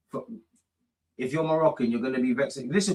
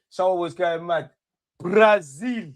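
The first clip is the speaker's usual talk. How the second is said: ordinary delivery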